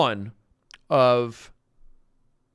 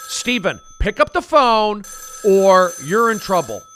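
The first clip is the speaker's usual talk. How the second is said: stern mom; 4 semitones higher, 7 dB louder than usual. Acted. Mom threatening.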